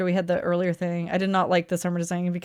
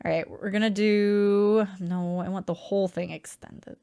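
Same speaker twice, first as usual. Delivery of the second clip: ordinary delivery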